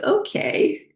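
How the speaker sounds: high-pitched, taken-aback okay; surprised okay. Retold or imitated. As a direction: let it jump up high in surprise. Taken aback by a curt reply.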